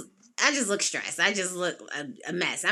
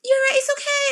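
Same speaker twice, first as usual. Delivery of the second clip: soothing. Retold or imitated. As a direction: soothe her like a worried friend. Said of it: "She was like".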